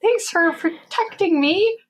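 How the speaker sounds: high, slow, mock-grateful retort; sarcastic thanks. Customer answering a useless security excuse, sarcastic.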